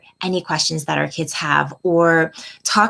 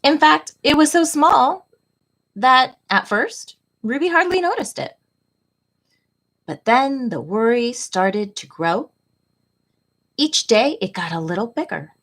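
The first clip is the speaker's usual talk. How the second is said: gentle, expressive picture-book read-aloud, often higher; reading to children; 4 semitones higher than usual. A narrator's reading. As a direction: read it like storytime for little kids. Reads picture book to class.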